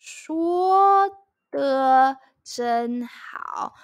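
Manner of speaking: 说得真好 stretched out word by word; teacher repeating 说得真好 at a crawl; very slow 说得真好. Shown as a demonstration. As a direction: drag out 说得真好 for learners to copy. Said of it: "something like this".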